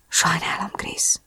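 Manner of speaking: soft, whispered apology; apologetic whisper. A narrator's reading. Drew apologises into Chris's hair, whispering. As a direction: whisper it close, barely audible. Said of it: "suttogta a hajamba".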